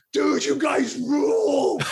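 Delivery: put-on high, slow drunken yell; drunk shout. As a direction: yell it like a wasted fan. Retold drunk fan shouting.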